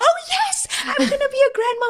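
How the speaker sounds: very high, loud squeal of delight; hammed-up ecstatic grandma-to-be; overjoyed mom. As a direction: imitate a mother shrieking with joy. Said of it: "she was the most excited".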